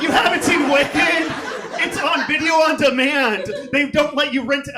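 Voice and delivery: hammed-up angry straight guy, half laughing; mock outrage. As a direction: play up fake fury at the fan who hasn't seen Wicked.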